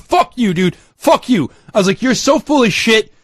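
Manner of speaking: angry swearing, pitched high; heated retold argument. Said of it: "I got into a fight with him".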